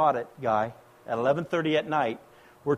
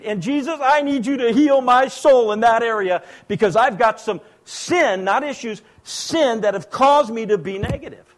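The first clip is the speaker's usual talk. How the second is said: loud, high, urgent pulpit delivery; full-force preaching; 7 semitones higher, 6 dB louder than usual. Real, not acted. Preacher urging the congregation to confess sin, preaching at full force. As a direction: preach it hard, pressing every phrase.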